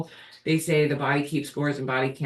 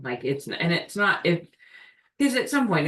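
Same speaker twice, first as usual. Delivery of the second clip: ordinary talk, nothing marked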